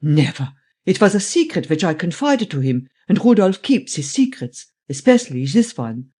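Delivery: put-on indignant German-accented old man; old German man, protesting. Voices old German Steinweg, protesting.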